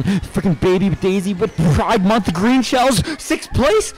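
slow, high, loud outrage; high angry yell. Real, not acted. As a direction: yell in disbelief. Dropped to sixth place.

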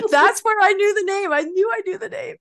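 suddenly getting the joke; excited realisation. Real, not acted.

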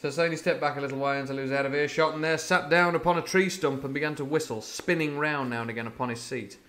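brisk, lively read-aloud; story narration. A narrator's reading. Storyteller reading Treasure Island aloud, lively. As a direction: carry the listeners along the beach scene.

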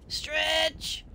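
strained upward urging; urging stretch. Acted.

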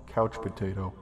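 usual speaking voice

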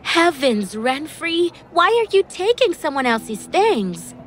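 high, exclaimed mock shock; feigned horrified surprise; pretended shock. A narrator's reading. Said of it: "pretending to be shocked".

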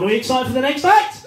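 loud crowd warm-up call; hyping the crowd. Real, not acted. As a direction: rouse a crowd with a rising yell. MC hypes up the crowd.

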